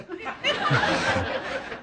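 a short real laugh under the breath; quiet laugh. Real, not acted. Laughing at his own story.

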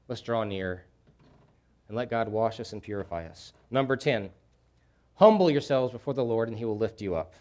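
ordinary talk

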